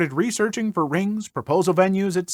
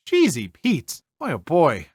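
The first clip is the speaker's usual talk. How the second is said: Minnesota exclamation; 3 semitones lower than usual. Pretend.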